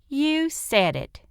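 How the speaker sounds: put-on high, slow, sassy child voice; sassy little girl. June Star backing up her brother, high and sassy. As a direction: drawl it out like a smart-mouthed girl.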